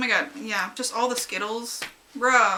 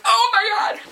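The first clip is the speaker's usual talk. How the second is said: squealing oh my god; 13 semitones higher, 8 dB louder than usual. Retold or imitated. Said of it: "and I was like".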